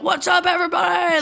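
high, rowdy holler to the room; loudmouth. Retold or imitated. Uncle arriving at the family holiday, loudmouth, all excited. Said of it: "he's always loudmouth".

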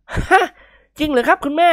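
high-pitched, astonished yelp; jolt, then ask in eager disbelief; startled. Acted. Guest jolted by the lady's permission, startled. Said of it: "นิกรสะดุ้งเฮือก".

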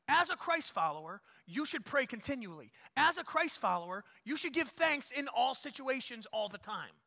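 loud, high preaching to the congregation; full-force preaching. Acted. Pastor exhorting the congregation, loud full-force preaching. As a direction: drive each line home from the pulpit.

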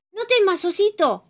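high, loud cartoon voice soothing; Bibi comforting. Bibi calming the frightened bear, high voice. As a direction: reassure the scared bear in a high voice. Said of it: "le dice Bibi".

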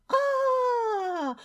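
very high scream of fright; frightened scream. Acted. Fisherman scared by a shark, screaming. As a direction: scream out in terror, drawn out. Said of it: "渔夫吓得大声尖叫".

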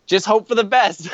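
lightly imitated carefree older friend; Dave. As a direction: toss it off as an unworried old guide. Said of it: "everything he says is relaxed".

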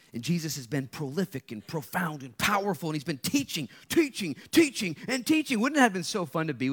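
mounting fervour; building preaching. Real, not acted. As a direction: drive it up to a fervent peak. Preaching at full force.

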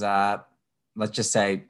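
ordinary delivery

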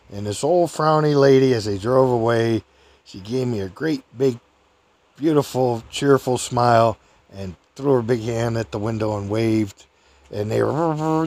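ordinary talk

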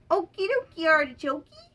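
high, loud storybook dragon; playful character voice. A narrator's reading. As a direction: chirp it up high for a young audience. Voicing Wheezy in the story.

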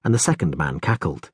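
ordinary delivery

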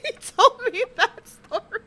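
laughing. Real, not acted. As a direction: let the laugh break into the words. Laughing at the wrestler's nickname.

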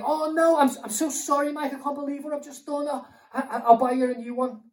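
high, loud panicked apology; Ed apologising frantically. Acted. Friend has spilt tea on speaker's laptop, flustered apology. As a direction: stammer out a mortified sorry. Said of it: "so Ed's like".

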